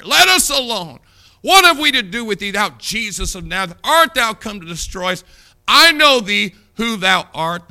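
high, loud challenge of a possessed man; shouted demonic challenge from the pew; demon's cry. Acted. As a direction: hurl it at Jesus like the demon. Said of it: "We hear this demonic voice".

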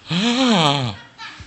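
long, high gasp; hammed-up gasp of shock; horrified gasp. Little boy hearing he will be sold, horrified gasp.

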